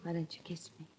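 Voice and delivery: soft breathless whisper of invitation; seductive whisper. Acted. Heiress inviting Race to kiss her, whispering. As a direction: breathe it close to his ear.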